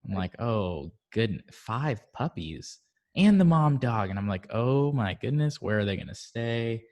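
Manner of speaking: usual speaking voice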